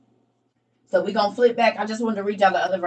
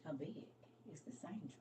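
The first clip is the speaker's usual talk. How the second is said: whisper; 24 dB quieter than usual. Real, not acted. Musing over angel's size.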